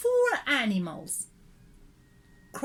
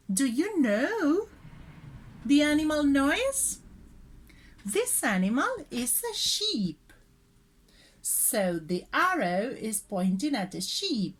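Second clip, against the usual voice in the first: normal talking voice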